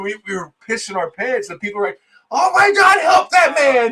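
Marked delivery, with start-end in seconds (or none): 2.3-3.9 high, loud mock cry for help; acted panicked shout. Imitating onlookers watching Frenchie on the belt, panicked mock shout. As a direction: shout in exaggerated alarm.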